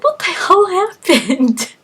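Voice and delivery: waking up with no idea where you are; bewildered. Retold or imitated. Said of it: "You're like".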